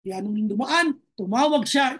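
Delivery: usual speaking voice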